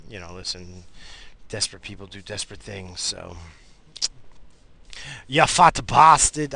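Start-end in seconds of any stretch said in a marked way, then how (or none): 5.3-6.6 put-on loud, slow Portuguese-accented insult; Grandpa's accent, shouted; Portuguese grandfather. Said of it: "My grandfather had a broken accent".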